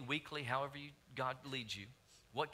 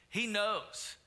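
emphatic; 7 semitones higher, 5 dB louder than usual. Real, not acted. Pastor stressing God knows.

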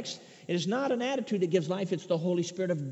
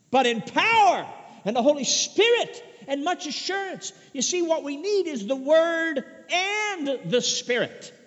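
loud, high-pitched preaching with punched key words; forceful preaching; 6 semitones higher, 6 dB louder than usual. Real, not acted. Preacher warning against minimizing the Word, forceful. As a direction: preach it with rising fervour.

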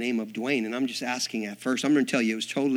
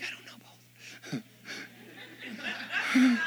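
slow whisper of confusion; whispered aside; 10 semitones higher, 4 dB quieter, slower than usual. Retold or imitated. Preacher answering wife, bewildered whisper. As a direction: mutter it under your breath.

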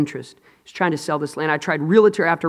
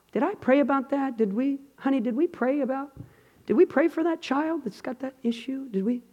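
soft, higher-pitched half-attentive murmur; mock casual bedtime prayer talk; offhand forgetful query; 8 semitones higher, 7 dB quieter than usual. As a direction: ask your spouse quietly, as an afterthought. Mimics casual, forgetful praying.

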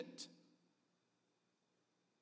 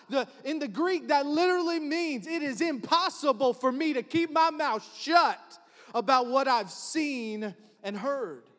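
excited preaching; 6 semitones higher, 5 dB louder than usual. Real, not acted. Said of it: "I'm a little excited this morning".

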